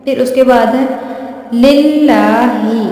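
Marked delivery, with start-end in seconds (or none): 1.6-2.8 लिल्लाही drawn out, thin light लाम; slow Quranic recitation. Shown as a demonstration. Teacher recites an example for students, slow and melodic. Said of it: "यहाँ पे भी लाम से पहले ज़ेर है".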